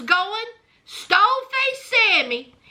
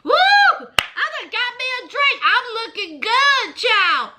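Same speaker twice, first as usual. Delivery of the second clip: excited Amber; 6 semitones higher than usual. Retold or imitated. Amber thrilled a stranger bought her a drink, high happy squeal. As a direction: gush over the free drink, voice way up. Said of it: "Amber said".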